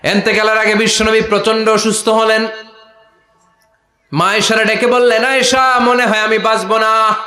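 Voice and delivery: high, loud, chanted sermon delivery; impassioned preaching. Acted. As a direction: cry out the sacred story from the pulpit. Preaches at full force.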